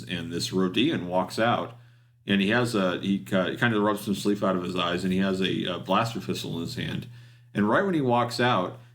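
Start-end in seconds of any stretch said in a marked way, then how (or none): none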